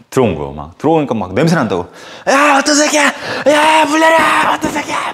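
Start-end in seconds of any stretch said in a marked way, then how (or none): 2.3-5.1 bang on the stall and yell; rowdy yelling. Retold or imitated. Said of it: "막 그러는데".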